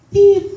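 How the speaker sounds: a sharp high beep, loud; mock scanner beep. Comic to the audience, mimicking the scanner's high beep. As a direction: chirp it like a checkout scanner.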